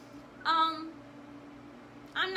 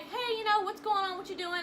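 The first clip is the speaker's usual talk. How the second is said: lightly acted, higher-pitched breezy hello; casual phone greeting; 6 semitones higher than usual. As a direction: imitate a boyfriend checking in casually. Acting out boyfriend's casual phone greeting.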